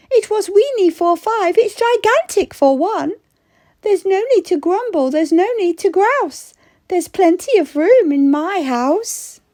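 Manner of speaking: put-on high, happy little old lady; old lady relieved. Old lady relieved her house is emptier, high and glad. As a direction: sigh of relief, now there's room.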